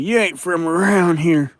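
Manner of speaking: put-on Alabama accent. Streamer imitates everybody he talks to, slow high Alabama accent. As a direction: imitate an Alabama local, stretching the words. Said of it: "I can't pick up the Alabama accent".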